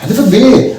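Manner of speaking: ordinary talk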